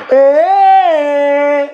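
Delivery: short loud cheer; mock cheer. Comedian faking the crowd's cheer, loud short yelp. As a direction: shout it like a fake fan.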